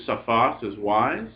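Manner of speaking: ordinary talk, nothing marked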